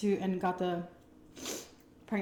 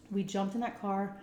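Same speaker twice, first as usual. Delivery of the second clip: ordinary delivery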